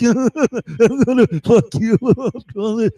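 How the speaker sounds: wobble through it as a scrambled-brained caricature; addled mock voice. Watcher threatens man while addled, juddering mock voice. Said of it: "his brain is all fucked up now".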